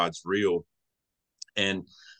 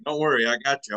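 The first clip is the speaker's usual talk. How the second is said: a light reassuring voice of God, a touch higher; mildly voiced God promising a worried man he's got him; 4 semitones higher, 4 dB louder than usual. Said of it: "God's like".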